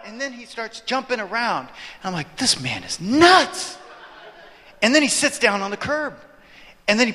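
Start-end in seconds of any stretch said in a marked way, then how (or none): 2.4-3.8 slow, high, stretched-out disbelief; retold astonishment, pitched up; incredulous. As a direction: drag out the last word in amazement. Retold disbelief at a stranger's antics.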